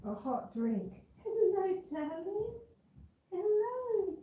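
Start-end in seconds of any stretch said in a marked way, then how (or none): 1.2-4.2 high, soft coo; tender, pitched-up darling-pet hello; pet talk. Real, not acted. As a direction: greet a beloved pet in a tiny high voice.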